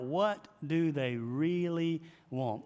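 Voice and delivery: slow, spaced words with REALLY stretched; deliberate, weighty REALLY; stress on REALLY. Retold or imitated. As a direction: hammer the question, dragging out REALLY. Repeating mentor's emphatic question.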